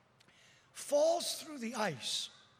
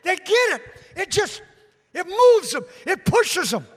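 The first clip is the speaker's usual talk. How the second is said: high, loud urgent pulpit shout; fired-up preacher at full volume; full-force preaching; 8 semitones higher, 12 dB louder than usual. Real, not acted. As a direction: punch out each short phrase to the congregation.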